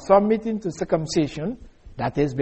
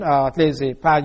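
usual speaking voice

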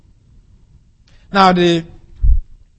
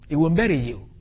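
usual speaking voice

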